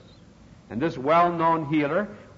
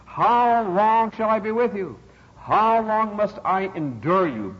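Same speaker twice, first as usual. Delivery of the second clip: raised, ringing, slow lament; higher, louder pulpit exasperation; exasperated rebuke; 7 semitones higher, 4 dB louder, slower than usual. Retold or imitated. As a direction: cry out the quoted question, drawing it out. Jesus' exasperated rebuke acted.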